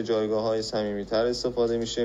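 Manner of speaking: normal talking voice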